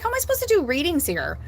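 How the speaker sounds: high and fast complaint; exasperated. Retold or imitated. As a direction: rush it out, pitched high. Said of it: "I'm like".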